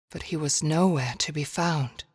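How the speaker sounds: ordinary delivery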